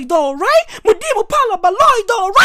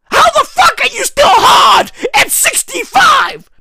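higher, louder disbelieving shout; incredulous shout; 5 semitones higher, 5 dB louder than usual. Real, not acted. Asking how the old man still functions, incredulous shout.

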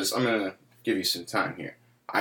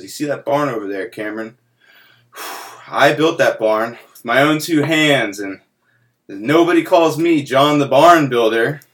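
louder, building complaint of the uncle; Uncle John voice; 4 semitones higher, 8 dB louder than usual. Retold or imitated. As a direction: let the uncle's grievance swell.

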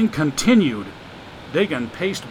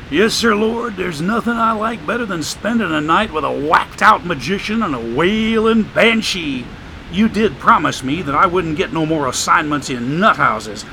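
streetwise homeless man; 2 semitones higher than usual. Acted. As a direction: play the wisecracking drifter, amused. Joshua praying and grumbling.

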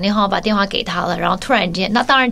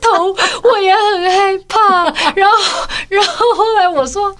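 put-on high, crying child's voice, much higher; whiny sobbing kid pleading her case; tearful little girl; 12 semitones higher than usual. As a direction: sob it out as the wronged little sister. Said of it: "崩泪这样子说".

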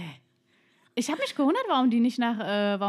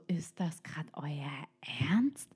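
slow whispered disbelief; hushed, drawn-out mock disbelief; incredulous inner thought; 5 semitones lower, 7 dB quieter, slower than usual. Retells her incredulous thought.

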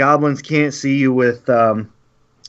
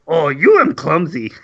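goblin voice; 2 semitones higher than usual. Acted. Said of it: "The goblin says".

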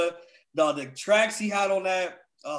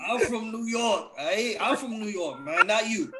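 insistent, badly faked New York accent; poor New York accent; 5 semitones higher than usual. Defending his roots to teasing friends, poor New York accent. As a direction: keep up the unconvincing New York act. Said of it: "That's not a New York accent".

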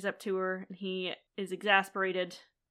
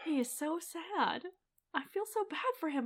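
pitying coo; 7 semitones higher, 7 dB quieter than usual. Real, not acted. Pitying sad character.

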